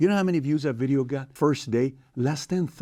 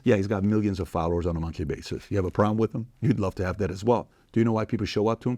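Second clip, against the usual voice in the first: usual speaking voice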